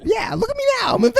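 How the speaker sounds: high-pitched mock boast; mock giddy boast. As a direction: ham up a giddy tourist crook. Mocking the hyped character.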